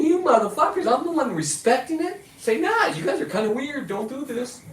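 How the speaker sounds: high, exasperated telling-off with a laugh; yelling at buddies who don't respect the bear. Retold or imitated. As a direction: scold the cops as idiots. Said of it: "I'm like".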